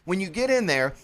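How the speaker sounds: ordinary talk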